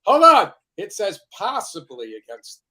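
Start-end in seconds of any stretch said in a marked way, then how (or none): 0.1-0.5 loud, firm cut-in over everyone; loud interruption. Real, not acted. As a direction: stop the others with a sharp call. Cutting in to stop the talk.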